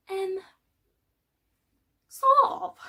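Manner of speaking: high-pitched, loud, unconvincing hedge; squeaked-out stalling; evasive hedging. Acted. Hedging answer about shopping centre.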